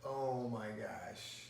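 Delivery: slow, hushed dawning sympathy; softened realization. Retold or imitated. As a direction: let each phrase land slowly, with concern. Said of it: "It changed from that to".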